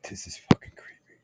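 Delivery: whispered, spooked aside; hushed, unnerved whisper; creeped-out whisper. Real, not acted. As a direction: drop to a whisper like something's watching. Spooked while showing sun images.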